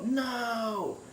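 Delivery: long high cry, held back in volume; drawn-out high wail, softened; retold yell. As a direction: imitate a distant scream without shouting. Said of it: "I heard him yell".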